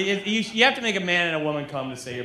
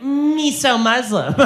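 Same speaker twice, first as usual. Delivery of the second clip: high, slow mock foreign accent in broken English; cartoonishly wrong foreign accent; deliberately wrong accent; 8 semitones higher, 5 dB louder, much slower than usual. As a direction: put on an absurdly inaccurate accent, drawn out and louder. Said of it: "do an accent if it's wrong".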